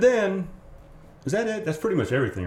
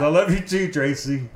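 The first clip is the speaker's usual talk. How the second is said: mock affection; 5 semitones higher, 5 dB louder than usual. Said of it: "Slayton says".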